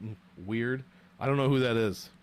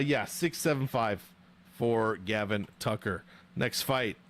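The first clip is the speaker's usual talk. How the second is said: ordinary talk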